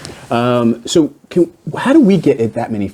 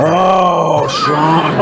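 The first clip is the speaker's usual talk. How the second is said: mock scolding call; 2 semitones higher than usual. Real, not acted. Scolding co-host's Queen joke.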